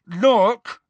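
barely contained anger. A narrator's reading. Ron to Luna, barely containing his anger. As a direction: hold the anger just under the lid. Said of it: "barely containing his anger".

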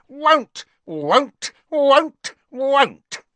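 very high, loud stubborn elf; shrill elf refusal. A narrator's reading. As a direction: shriek each won't like a furious elf. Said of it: "voice had risen to a scream".

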